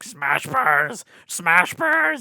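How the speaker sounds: high squeaky gremlin chant. Acted. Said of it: "gremlin that lives in his walls".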